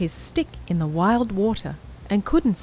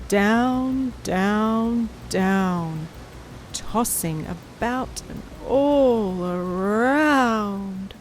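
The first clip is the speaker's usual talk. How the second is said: lilting, stretched tossing; much slower than usual. A narrator's reading. Narrator acting out the tossing raft, slow lilting. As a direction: draw it out like rocking waves.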